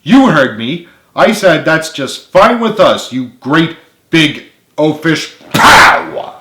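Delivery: loud, high, slow defiant taunt; defiant yell. Acted. Carly repeating her insult to James, defiant and loud. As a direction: throw it back in his face.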